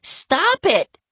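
very high, loud shriek; scream. A narrator's reading. Livy to Bradley snapping at her nose, screaming. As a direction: shriek it to make him quit. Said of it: "Livy screamed".

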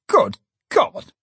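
high, shocked cry; horrified exclamation. A narrator's reading. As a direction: cry out aghast, pitch leaping up. Alderman hears of the suicide.

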